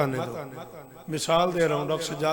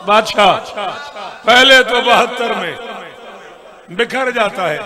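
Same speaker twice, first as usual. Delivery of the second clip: loud, slow verse recitation; declaimed elegy; 3 semitones higher, 11 dB louder, slower than usual. Acted. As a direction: let the line ring out slowly and loudly.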